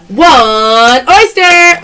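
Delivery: very slow, loud, stretched call; slow loud showy callout; drawn-out announcement. Real, not acted. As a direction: announce the pick to viewers. Live sale callout.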